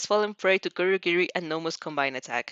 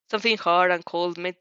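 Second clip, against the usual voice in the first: plain reading voice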